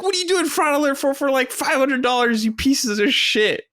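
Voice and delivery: high, fast, outraged rant that slows at the end; mock furious rant. Host retelling how he raged at the fraud alert, in a high hammed-up rant. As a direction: rail at the bank in hammed-up fury. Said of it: "Just furious".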